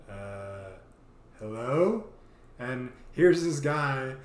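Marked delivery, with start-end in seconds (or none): 0.1-2.1 hesitant greeting. Retold or imitated. Opening door to stranger.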